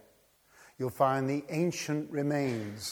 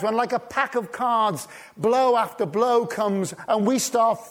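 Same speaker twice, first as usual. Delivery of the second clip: loud, higher, building preacher's cry; preaching at full force; 8 semitones higher, 7 dB louder than usual. Acted. Preacher stirring the congregation, full-force preaching. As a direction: drive it home from the pulpit.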